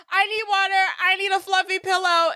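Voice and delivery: high-pitched mock panic; imitated freak-out. Woman demands comforts from old friend, imitated freak-out. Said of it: "she freaks out".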